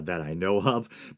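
ordinary delivery

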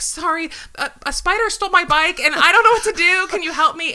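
high, loud mock frantic plea. Wife begging a passing couple to help with the spider, high and loud.

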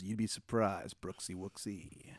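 soft genie voice, slowing into a hush; shrugging genie. Acted. Genie shrugging at the player, soft and drawn out. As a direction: toss it off softly, drag out the nickname. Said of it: "he just kinda shrugs and he goes".

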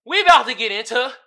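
loud, high hype announcement; loud, high Meme Time announcement; hyped segment intro. Real, not acted. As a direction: announce the segment like a show host.

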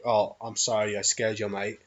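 low, soft attempt at an Australian accent; bad Australian accent. Imagining Steve Irwin apologising to the stingray, bad Australian accent. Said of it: "excuse my really bad accent".

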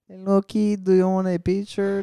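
high, sweet, put-on costume-hero greeting; a lightly hammed-up superhero voice for children; mock Spider-Man voice. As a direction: play the friendly Times Square Spider-Man for a kid. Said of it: "'cause that's how he talks".

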